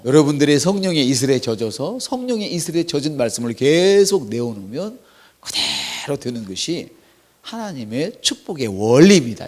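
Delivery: ordinary delivery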